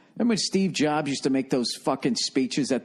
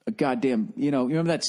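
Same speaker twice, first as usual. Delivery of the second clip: ordinary delivery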